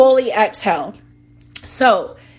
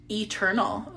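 dramatic and serious; 3 semitones lower, 10 dB quieter than usual. Real, not acted. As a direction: draw it out softly with weighty seriousness. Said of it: "that sounds dramatic and serious".

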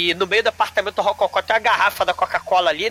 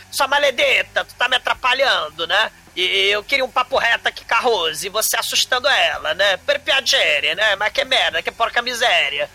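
mock Italian villain rant; Italian accent; 2 semitones higher than usual. Frank voiced with Italian words.

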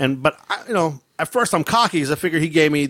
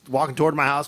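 usual speaking voice

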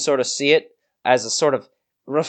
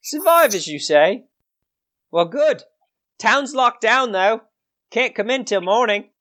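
high, swooping crabby voice; high-pitched and unwelcoming; grumpy gatekeeper; 8 semitones higher than usual. Acted. Gatekeeper refusing travellers entry till morning, grumpy high voice. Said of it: "this gatekeeper doesn't seem to be in any kind of, any kind of good mood".

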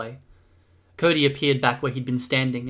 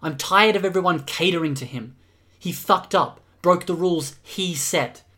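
heated, higher-pitched complaint; fed up; 5 semitones higher, 3 dB louder than usual. Acted. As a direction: vent in exasperation, stressing the key words. Acted angry argument in story.

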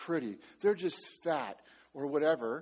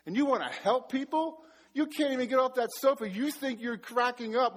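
a higher, scornful taunt; mocking inner voice; 6 semitones higher than usual. Inner voice taunting the would-be pastor, higher scornful jab. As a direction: jeer at him from inside his head. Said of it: "some voice, you know, saying".

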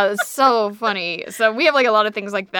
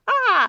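refreshed sigh; 11 semitones higher than usual. Retold or imitated. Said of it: "I was like".

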